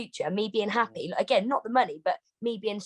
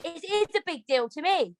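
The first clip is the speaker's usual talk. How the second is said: normal talking voice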